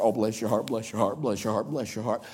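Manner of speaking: automatic, without thinking. Shown as a demonstration. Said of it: "we just say it without even thinking".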